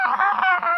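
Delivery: high, loud mock scream; sudden high-pitched, loud "ah" scream; imitated scream. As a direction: scream as the girl running off. Voices the fleeing girl's scream.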